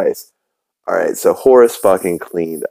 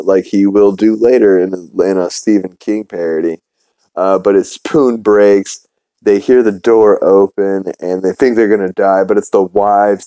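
normal talking voice

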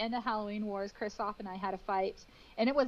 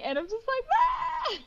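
shrill retold cry, much higher; much higher and louder wail; high mock wail; 5 dB louder than usual. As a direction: let out a high distressed ah. Breaking down in tears on camera.

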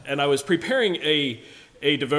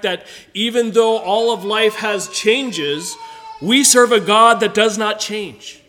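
higher, louder, emphatic sermon delivery; impassioned preaching; 5 semitones higher, 6 dB louder than usual. Real, not acted. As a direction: preach it out to the congregation, voice lifted. Preacher rises into full preaching.

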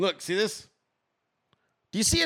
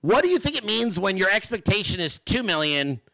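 usual speaking voice

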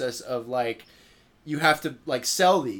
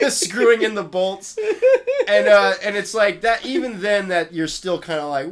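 usual speaking voice

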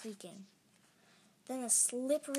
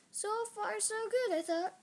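higher, pleased inner voice; hopeful thought; 10 semitones higher, 4 dB louder than usual. A narrator's reading. Narrator sizes up the new monster, hopeful thought. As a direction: lift it with rising hope.

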